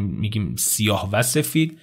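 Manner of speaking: usual speaking voice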